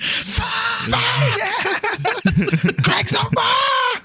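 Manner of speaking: high, slow hammed-up screams; mock panic. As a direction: play a passenger screaming in terror. Mock live disaster reaction.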